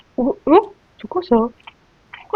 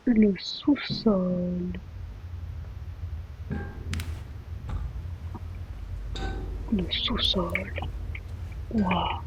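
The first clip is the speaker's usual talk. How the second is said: slow, softer dread-filled drawl; slow hushed spooky musing; ominous; 4 semitones lower, 10 dB quieter, much slower than usual. Real, not acted. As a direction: let the word sink in darkly. Discovering the ominous basement.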